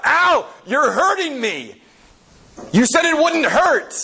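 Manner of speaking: high pained yelps; crying out in pain. Retold or imitated. Spirit crying out in pain at the angel, high and hurt. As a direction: yelp as the burning bites. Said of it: "the spirit cries out".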